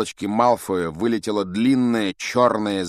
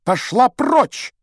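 high, drawn-out shout; furious yell; 8 dB louder, much slower than usual. A narrator's reading. Boy yelling at a snake about to strike, commanding shout. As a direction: bellow it at full stretch, high. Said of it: "заорал на змею".

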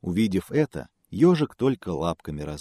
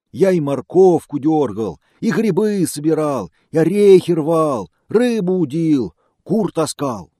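loud, high lamenting bear; 9 semitones higher, 9 dB louder than usual. Acted. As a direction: list woes like a sorry bear.

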